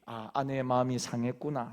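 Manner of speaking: soft, quiet, understanding; gentle realization. Retold or imitated. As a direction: realize softly that she is hurt. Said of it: "남편분이". (조용하고 부드럽게 깨닫는 남편)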